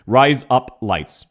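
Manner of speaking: "Rise up lights" slowly, word by word; slow strong accent. Shown as a demonstration. Streamer demonstrating the words in an accent, slow and separated. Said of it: "in a relatively strong accent".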